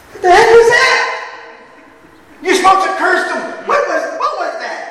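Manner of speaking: high, loud, furious shout; angry king. Acted. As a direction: yell at him like an outraged king. King enraged at Balaam.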